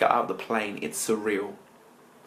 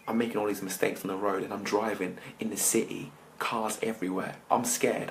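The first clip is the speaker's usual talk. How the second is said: ordinary talk, nothing marked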